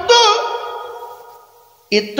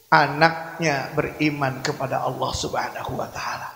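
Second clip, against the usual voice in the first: hushed hope; 11 semitones lower, 10 dB quieter than usual. Acted. Prophet's hope, near whisper.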